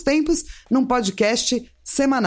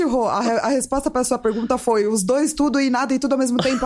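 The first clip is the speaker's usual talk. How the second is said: ordinary talk, nothing marked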